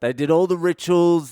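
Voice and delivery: normal talking voice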